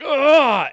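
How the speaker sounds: high, loud guttural cry; ogre's pained roar. Acted. Slashed ogre swinging back, loud pained roar. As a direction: roar out in pain. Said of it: "and he's like".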